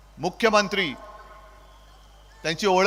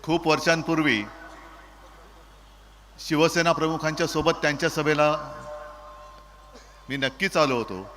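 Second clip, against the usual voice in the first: fiery rally speech; 4 semitones lower than usual. Real, not acted.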